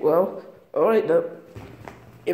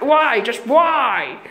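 ordinary delivery

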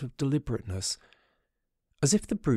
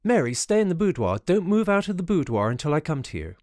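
raised urgent call across the house; 8 semitones higher, 5 dB louder than usual. A narrator's reading. Beaumont calling to Mary to stay in the boudoir, raised and urgent. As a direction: call out sharply to her in the next room.

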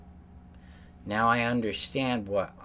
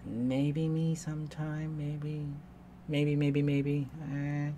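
higher, slow and hesitant, "maybe me sometime" tossed off shyly; mock timid codependent; 6 semitones higher, slower than usual. Therapist imitating a codependent to viewers, higher and hesitant. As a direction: play the codependent who barely dares to want anything. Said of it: "Codependency is you".

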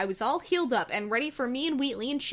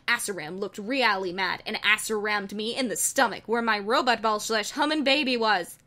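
usual speaking voice

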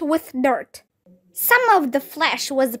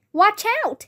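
high-pitched warning, only lightly raised; mild yell; 8 semitones higher than usual. A narrator's reading. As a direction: call out a quick warning without full volume. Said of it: "he yelled".

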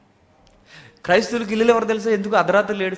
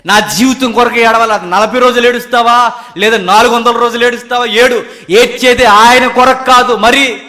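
high, loud pulpit exhortation; forceful preaching; 6 semitones higher, 11 dB louder than usual. Real, not acted. Preacher challenges congregation on whom they weep for, forceful preaching. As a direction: press the challenge hard on the listeners.

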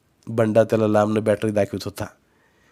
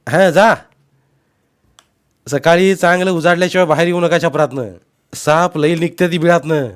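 raised, higher voice; shouted advice; 7 semitones higher, 7 dB louder than usual. A narrator's reading. Uncle warning departing nephew about snakes, shouting. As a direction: call cautions over the water.

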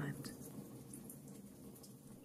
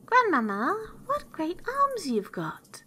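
put-on amazed little girl; 11 semitones higher than usual. A narrator's reading. Girl marvels at granny in bed, high and amazed. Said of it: "being greatly amazed to see".

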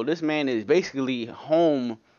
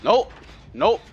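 panicked; 7 semitones higher, 6 dB louder, slower than usual. Real, not acted. Panicked repeated nope.

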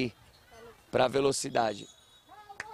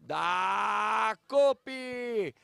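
high shouted goal call, bursting with joy; explosive goal commentary; 9 semitones higher, 4 dB louder, much slower than usual. Real, not acted. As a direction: scream the goal with full joy. Free kick scores, commentator erupts. (grito de gol explosivo)

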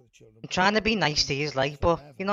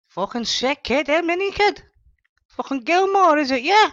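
mocking; 11 semitones higher, 6 dB louder than usual. Dealer mocking customer, rising aggression.

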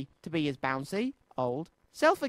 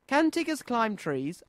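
put-on curious child; 6 semitones higher than usual. A narrator's reading. Little Roo asks Tigger.

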